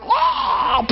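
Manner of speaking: high hammed-up scream; the demon breaking out; sudden shriek. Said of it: "all of a sudden he's going".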